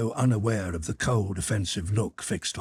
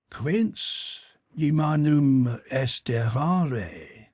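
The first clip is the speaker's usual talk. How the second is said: put-on French-accented Latin; 6 semitones higher, 4 dB louder, much slower than usual. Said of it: "pronouncing the Latin words with a French accent".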